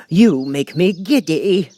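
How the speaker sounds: high, loud, slow scream; screamed Queen. A narrator's reading. The Queen at the bowing gardeners, an irritated scream. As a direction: shriek it in irritation. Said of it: "screamed the Queen".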